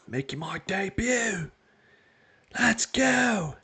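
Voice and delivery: high, hammed-up British accent; mock British accent. New manager's debut interview, in a hammed-up British accent. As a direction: cheer it like a British lad. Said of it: "best British accent".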